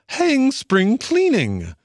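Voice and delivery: high, slow defiant cry; fed-up mole. A narrator's reading. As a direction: fling the chores away, mole.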